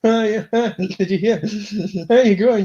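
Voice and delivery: loud, amused laugh through the words; chuckling as you get the question out. Real, not acted. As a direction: talk through your own laughter. Laughing while joking with others.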